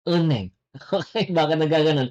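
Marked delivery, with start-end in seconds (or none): 0.1-0.5 "Uneng" run together without h; correct linked pronunciation. Shown as a demonstration. Korean teacher repeating the right form, linked pronunciation. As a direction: say "Uneng" as u-neng. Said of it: "that is pronounced as".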